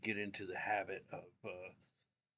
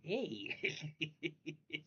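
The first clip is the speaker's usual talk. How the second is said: toothless hey; 5 semitones higher than usual. Retold or imitated. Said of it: "I'm gonna go".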